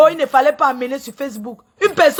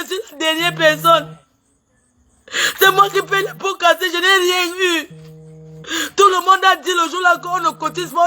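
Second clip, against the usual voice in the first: normal talking voice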